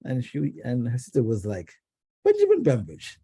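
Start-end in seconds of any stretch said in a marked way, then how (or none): 2.2-3.2 name said high in a mock British lilt; posh British accent, lightly imitated. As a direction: sound it out grandly British. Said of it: "sounds so British".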